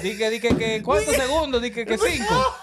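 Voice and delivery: high-pitched laughing speech; laughing hard. Real, not acted. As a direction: laugh through the words. Laughing retelling fast tire change.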